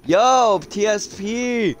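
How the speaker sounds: loud, high, drawn-out hail; excited shout-out. Real, not acted. Streamer greeting his moderator in chat, excited holler.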